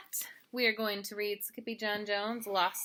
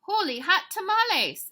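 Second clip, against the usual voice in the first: high, loud cartoon amazement; startled exclamation; 13 semitones higher, 11 dB louder than usual. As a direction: yelp it out in storybook astonishment. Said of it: "exclaimed Skippy John Jones".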